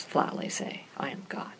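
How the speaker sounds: leaning in to whisper the point to the group. Real, not acted. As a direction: drop to a whisper for the big claim. Hushed point about Jesus' claim.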